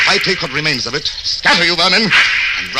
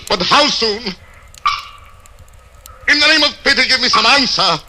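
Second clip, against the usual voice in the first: desperate pleading; 9 semitones higher than usual. Acted. Prisoner begs the inquisitor how soon the blade falls, desperate higher plea. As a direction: plead as if your life hangs on it.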